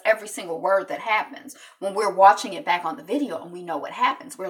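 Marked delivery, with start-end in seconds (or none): none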